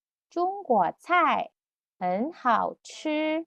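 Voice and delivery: teacher modelling slowly; slow Mandarin model. Shown as a demonstration. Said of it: "Kak Rika ikutin".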